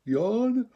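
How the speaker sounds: high yawn falling down; yawn sigh. Shown as a demonstration. Teacher leading the class in a yawn sigh, high falling. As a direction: yawn from the top of the voice. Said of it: "Starting as high as you can".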